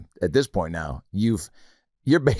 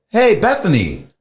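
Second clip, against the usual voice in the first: high, loud call sliding down; hammed-up surprised hello; acted awkward greeting; 10 semitones higher, 7 dB louder, slower than usual. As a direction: greet a date caught in an odd spot. Acts awkward greeting on Tinder date.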